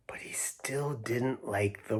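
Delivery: slow hushed whisper; storybook whisper. A narrator's reading. Sharing Harry's grudge with the kids, slow whisper. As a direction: whisper it like a secret.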